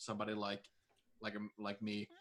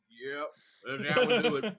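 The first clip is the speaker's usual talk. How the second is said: cocky action-movie line; smug hero; 2 semitones higher, 4 dB louder than usual. Acted. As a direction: play it like a movie poster hero. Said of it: "And I'm like".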